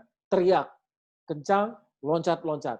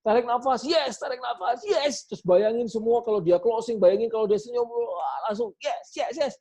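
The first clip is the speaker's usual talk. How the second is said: high, loud pumped-up yes; hyped self-talk; 6 semitones higher, 3 dB louder than usual. Shown as a demonstration. Salesman pumping himself up before closing, excited. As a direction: psych yourself up in the bathroom. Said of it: "lakukan seperti anak kecil tadi".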